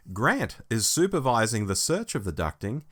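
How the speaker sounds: ordinary talk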